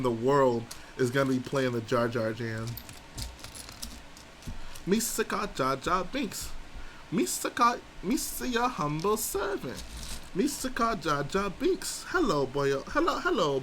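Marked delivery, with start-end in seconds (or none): 4.9-12.1 high goofy Gungan voice sung over the beat; squeaky high Jar Jar chant; Jar Jar sing-song. Acted. Jar Jar voice, softer. 12.1-13.6 Gungan greeting. Acted. Jar Jar greeting boyos.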